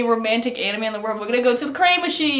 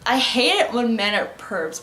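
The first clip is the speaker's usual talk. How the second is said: normal talking voice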